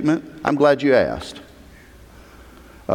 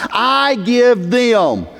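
I GIVE THEM drawn out slowly, high-pitched; emphatic; 10 semitones higher, 4 dB louder, much slower than usual. Retold or imitated. Preacher showing how emphatic Jesus is, emphatic. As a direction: hit each word of "I give them" hard and slow. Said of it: "how emphatic Jesus is about this point".

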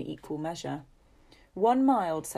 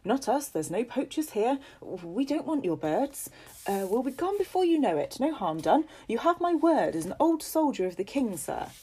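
higher, eager pleading voice; anxious traveller; 7 semitones higher, 3 dB louder than usual. Acted. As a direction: reassure the landowner quickly.

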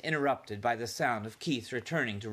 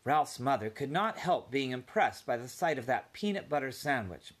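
plain reading voice

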